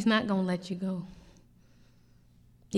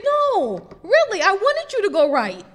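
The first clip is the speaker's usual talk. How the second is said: loud, high insistent plea; Jesus pleading; 9 semitones higher, 7 dB louder than usual. Acted. Jesus warns the lost believer, loud, high, insistent. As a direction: press the point, slowing at the end. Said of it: "I say".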